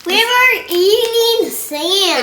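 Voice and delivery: loud excited shout. Acted. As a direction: yell it out with glee. Kid repeating the sand line.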